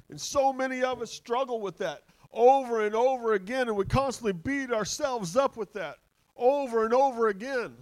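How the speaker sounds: loud, high-pitched fervent cry; impassioned preaching. Real, not acted. Preacher passionately laments shared struggle.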